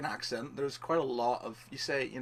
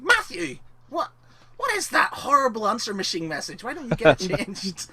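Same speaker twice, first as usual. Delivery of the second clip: higher, louder scolding mum; mum telling off; 10 semitones higher, 9 dB louder than usual. Retold or imitated. Retelling his mother scolding about the answer machine. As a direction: tell him off like an annoyed posh mother. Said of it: "angry people, especially my mother, going".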